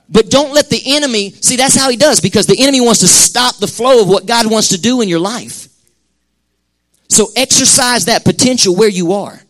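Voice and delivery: high, loud preaching cry; preaching at full force. Acted. Pastor urging the congregation, preaching at full force. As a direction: lift the church with a ringing warning. Preacher raising voice about the enemy.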